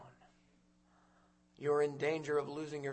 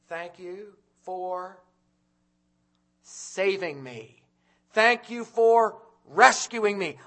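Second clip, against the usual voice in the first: grateful thanks; 4 semitones higher, 13 dB louder, much slower than usual. Retold or imitated. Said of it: "you're gonna say".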